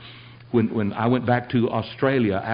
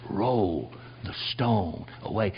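slow command; 5 semitones lower, 6 dB quieter, slower than usual. Retold or imitated. Acts out the master's command.

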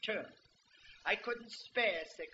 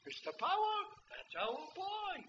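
put-on proud old man; 11 semitones higher than usual.

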